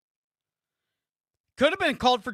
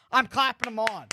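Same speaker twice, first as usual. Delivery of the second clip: ordinary delivery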